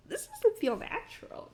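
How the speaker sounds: sudden dawning alarm; alarmed realization. Retold or imitated. Retold alarm about her knee.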